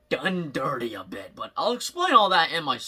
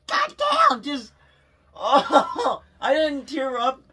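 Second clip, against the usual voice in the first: high, cracking, choked-up cry; near tears; 8 semitones higher, 3 dB louder, much slower than usual. Real, not acted. Fan overcome by the franchise ending, near tears. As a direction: let the voice break with emotion. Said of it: "I just wanna cry".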